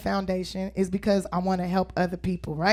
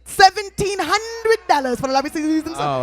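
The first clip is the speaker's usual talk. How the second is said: excited auctioneer calling bids; 9 semitones higher than usual. Acted. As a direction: rattle off the bids to the crowd.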